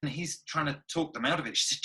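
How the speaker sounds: ordinary talk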